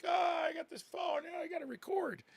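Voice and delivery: very high, whiny mock panic; mocking phone filmers. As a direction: mimic a silly bystander in a high, stretched voice. Mimics crowd filming on phones.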